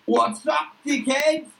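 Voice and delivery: high brash bloke bursting through the door; Duck's voice. Acted. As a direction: pitch it way up, cocky and crude. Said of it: "Duck kicks open the door and goes".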